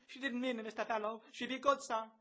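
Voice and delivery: very high falsetto plea; pleading girl. A narrator's reading. Florrie shields Bloom from Bello, pleading high. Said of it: "Florrie, hiding her with her gown".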